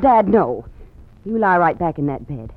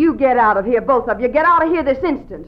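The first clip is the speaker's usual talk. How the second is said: indignant order; 5 semitones higher than usual. Acted. Daughter orders bandits out.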